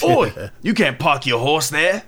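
Cockney guard scolding a rider, drawn out. Pretend. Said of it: "in his standard Cockney accent".

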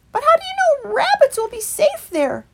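high, loud doubtful rabbit; young rabbit. A narrator's reading. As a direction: ask it high and wary. Fickle asks.

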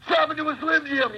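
high, booming wrestler impression; loud Macho Man. The version everybody likes to do, loud and high. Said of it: "the really loud Macho Man".

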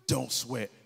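calm mother's advice. Retold or imitated. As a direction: soothe him like Mom does. Mother's calm motto voiced.

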